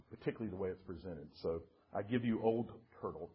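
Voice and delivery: ordinary delivery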